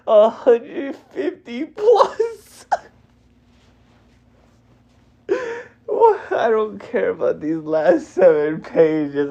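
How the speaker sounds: high, upset wailing protest; tearful disbelief. Real, not acted. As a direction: protest through tears. Grieving the dead character.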